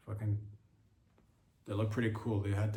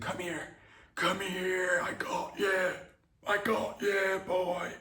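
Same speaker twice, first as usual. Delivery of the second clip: crying dad; 7 semitones higher, much slower than usual. Acted. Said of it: "Mike starts to tear up".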